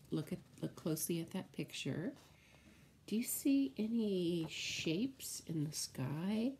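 usual speaking voice

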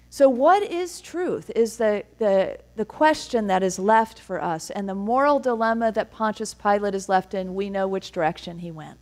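ordinary talk, nothing marked

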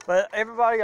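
ordinary talk, nothing marked

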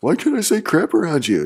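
put-on high, loud kid's complaint; imitating a protesting kid. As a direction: mimic a kid demanding to know why. Mimics kid arguing back.

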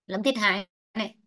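ordinary delivery

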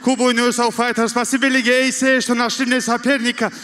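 ordinary talk, nothing marked

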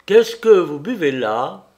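sharp alarmed question. Acted. Lupin seizes her arm.

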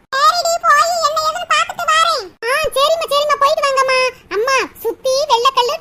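ordinary talk, nothing marked

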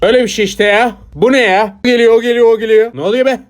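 high, loud, agitated outburst; agitated yelling. Acted. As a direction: yell it high, in rising agitation. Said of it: "Bağırma lan". (tiz sesle bağırarak, telaşlı)

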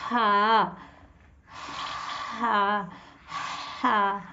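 हा breathed from the throat, thin; demonstrating the throat letter ha; throaty ha. Shown as a demonstration. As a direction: push air from the throat for हा. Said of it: "हलक में से हवा की आवाज़".

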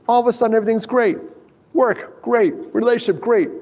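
feigned cheerfulness. Retold or imitated. Brother pretending to big brother that life is great, feigned cheer. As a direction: sell a rosy front. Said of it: "he was pretending".

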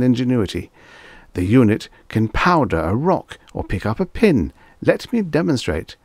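high-swooping, showy sales patter; eager salesman. Acted. Salesman showing off a robot to a customer, eager. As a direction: pitch it grandly with big swings in tune.

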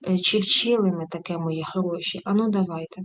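ordinary delivery

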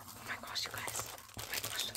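hushed, breath-only whisper of amazement; awed whisper. Real, not acted. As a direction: whisper it in sheer excitement. Awed at opened parcel.

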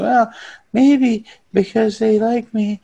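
high, loud put-on voice of a gullible kid; mock naive youngster. Podcaster voicing a naive teenager's reasoning, mock hopeful. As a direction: push the pitch way up, imitating naive hope.

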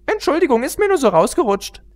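very high, bouncy pony voice; giddy Pinkie Pie. Acted. Pinkie Pie blurting a joke mid-standoff, very high. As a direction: pop the joke out high and silly.

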